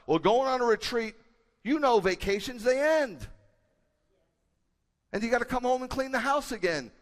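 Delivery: loud, high preaching voice; fiery pulpit preaching; full-force preaching. Acted. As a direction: preach it out to the whole room.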